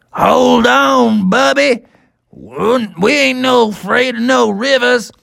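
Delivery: high, stretched-out folksy creature; fearless cowboy triceratops. Acted. Buckaroo reassuring Bubby at the river, bold. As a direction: boast it slowly and heartily.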